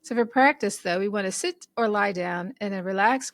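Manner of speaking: usual speaking voice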